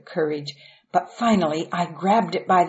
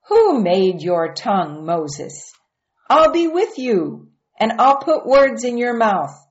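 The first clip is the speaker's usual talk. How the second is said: louder, higher, firm rebuke; God's voice; 5 semitones higher, 6 dB louder than usual. A narrator's reading. God answering Moses's excuse, louder and firmer. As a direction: thunder it back at him.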